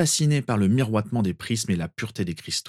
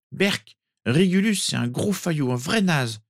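put-on disgusted small boy; 8 semitones higher than usual. A narrator's reading. Sirius about Régulus before sleep, disgusted. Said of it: "murmura-t-il".

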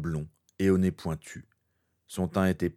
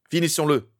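raised, high battle challenge; loud challenge; 8 semitones higher, 7 dB louder than usual. Acted. Harry challenges Voldemort to duel. (défi lancé fort)

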